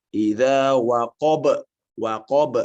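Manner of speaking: slow "Idza waqab" with the b let go at once; released qalqalah. Shown as a demonstration. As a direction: release the b of "waqab" immediately, no hold. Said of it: "Belum, belum".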